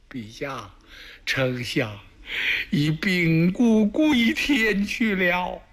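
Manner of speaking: slow, tearful wail of grief; a hint of a loyal minister weeping out the death news, slow; crying report. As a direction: drag the words out through sobs. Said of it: "放声大哭".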